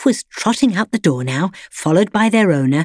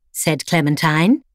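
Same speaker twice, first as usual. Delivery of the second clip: plain reading voice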